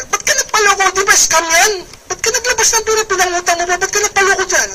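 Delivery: high, mocking challenge from a doubter; doubting relative. Skeptic to new recruit, high and mocking. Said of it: "O kunwari".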